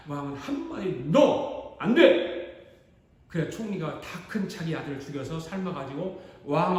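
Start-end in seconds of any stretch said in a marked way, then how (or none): 1.1-2.4 king's sharp refusal. Acted. King refuses the plea.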